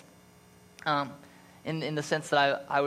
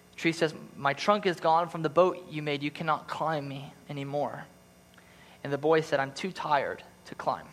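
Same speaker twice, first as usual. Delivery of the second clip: story narration; 4 semitones higher than usual. A narrator's reading. Pastor reading The Giving Tree to church, tender storytelling. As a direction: read it like a bedtime story to the congregation.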